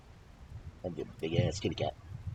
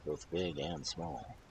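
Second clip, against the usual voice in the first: ordinary delivery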